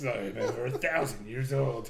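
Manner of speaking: low, mock-gruff hero impression; gruff, awful voice. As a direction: imitate the gruffest, worst movie-hero delivery. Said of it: "he's got the gruffest, like, awful voice".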